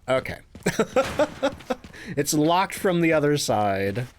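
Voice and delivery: normal talking voice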